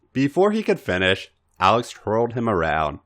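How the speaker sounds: usual speaking voice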